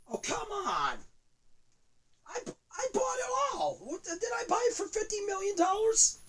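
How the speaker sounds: high, strained crying shout; anguished cry. Acted. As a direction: wail in disbelief.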